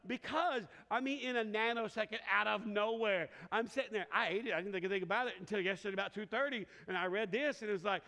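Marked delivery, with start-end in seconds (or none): none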